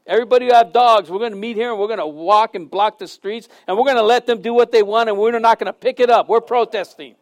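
fast, run-on protest announcement; mock rallying rant. Preacher rallying dog owners to a protest, mock rant. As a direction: rattle it off like a mock organizer.

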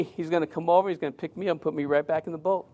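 ordinary talk, nothing marked

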